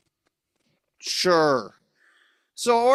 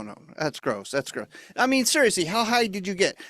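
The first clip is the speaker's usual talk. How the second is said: ordinary talk, nothing marked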